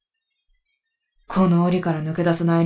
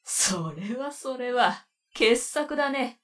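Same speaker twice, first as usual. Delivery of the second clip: mocking; 7 semitones higher, 5 dB quieter, slower than usual. Demon mocks the outcast girl.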